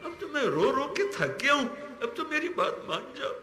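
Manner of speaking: put-on high, tearful child's plea to mother; crying child pleading. Child begs mother to give in, crying. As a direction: wail like a worn-out child begging mother. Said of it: "جیسے بچہ روتے روتے اماں سے کہتا".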